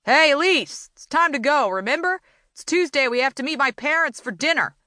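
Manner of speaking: high, impatient yell through a door. A narrator's reading. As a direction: call out high and demanding. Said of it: "Then the yelling started".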